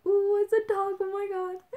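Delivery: high, loud gushing over a dog; excited squeal. Retold or imitated. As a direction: squeal like you just spotted a puppy.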